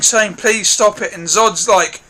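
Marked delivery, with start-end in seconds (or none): none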